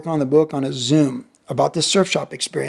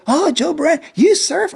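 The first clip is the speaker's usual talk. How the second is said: much higher, teasing classmate voice; mock schoolgirl voice; 11 semitones higher, slower than usual. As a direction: ham up an impressed schoolgirl. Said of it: "these girls from my classroom".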